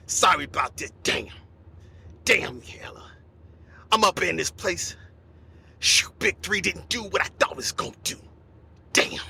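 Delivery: low, fed-up growl of a broke star. Retold or imitated. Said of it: "He's like".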